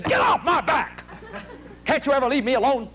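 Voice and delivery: high-pitched, indignant snapping; mock furious outburst, voice jumping high; acted angry outburst. Acted outburst of disturbed aunt.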